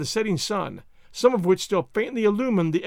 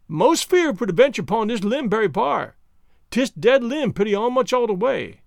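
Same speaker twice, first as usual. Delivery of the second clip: higher-pitched dialect voice, mildly fearful; Jupiter, wary; 3 semitones higher, 3 dB louder than usual. Acted. Servant up a tree reporting a dead limb, wary higher voice. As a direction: voice the worried servant calling down in dialect.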